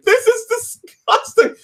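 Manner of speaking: very high, drawn-out squeal; hammed-up grossed-out cry; retold disgust. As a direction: squeal in mock revulsion. Retold disgust at spraying blood.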